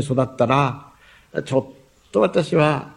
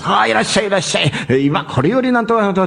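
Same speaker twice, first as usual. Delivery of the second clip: higher-pitched mock barker's call; imitated circus barker; 6 semitones higher, faster than usual. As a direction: call the crowd into the tent like a showman. Imitates circus barker calling customers in.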